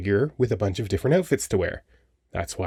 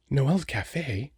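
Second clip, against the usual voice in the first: delighted gasp; 4 semitones higher, 3 dB quieter, much slower than usual. Acted. Gasp at a date invitation.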